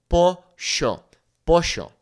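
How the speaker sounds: "pollo" with a "sh" sound, Argentine style; Argentine accent. Shown as a demonstration. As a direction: say "pollo" as "posho". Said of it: "they might say something like sh".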